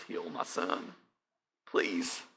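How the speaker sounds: begging on his knees. Acted. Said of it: "falling on his knees, begging Jesus".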